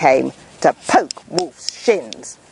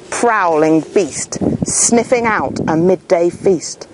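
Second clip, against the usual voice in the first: plain reading voice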